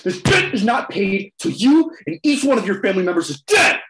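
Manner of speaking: shouted high and loud, full of rage; furious shout. Acted. Villain roars his threat at the family in fury. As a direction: roar the threat at the whole family.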